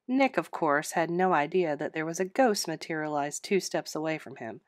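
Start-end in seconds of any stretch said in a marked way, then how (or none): none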